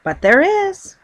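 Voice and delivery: high and loud, firmly insisting; emphatic insistence. Acted. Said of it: "he insisted".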